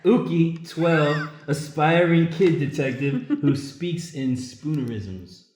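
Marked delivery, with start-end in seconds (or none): none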